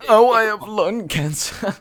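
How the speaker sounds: slow, high mocking whine. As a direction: drag it out, mocking her. Mocking the girl's excuse.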